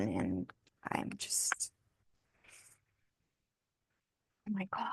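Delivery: choked up, almost whispering; real sadness trailing off; overcome with sadness. Real, not acted. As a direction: voice sinking to a breathy hush. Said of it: "I'm, like, really sad".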